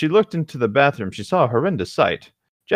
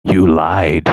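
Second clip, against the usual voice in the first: creepy Jeff; 4 semitones lower, 6 dB louder, slower than usual. Acted. Jeff's creepy last line.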